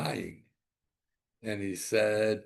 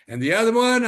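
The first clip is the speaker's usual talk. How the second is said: far higher and louder burst; sudden outburst; 13 semitones higher, 12 dB louder, faster than usual. Retold or imitated. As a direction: throw it out loudly. Yirmiyahu dismissing Plato.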